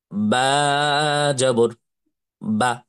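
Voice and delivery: first "বা" stretched, final "বা" cut short; long name, short vowel. Shown as a demonstration. Teacher showing where to stretch, long then short "বা". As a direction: hold the letter name "বা", then "জবর", then a quick "বা". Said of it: "আমরা ওর নামে টান দিলাম".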